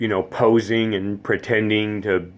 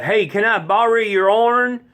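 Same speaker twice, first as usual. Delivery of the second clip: put-on mountain Tennessee accent; 13 semitones higher, 4 dB louder than usual. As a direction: ask a favour in a thick East Tennessee accent. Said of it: "born and bred Tennessean from the mountains".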